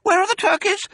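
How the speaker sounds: very high, loud little-fox voice; smallest fox. A narrator's reading. Smallest fox stares into gloom, high puzzled question. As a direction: ask in a piping cub voice. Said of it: "asked the smallest fox".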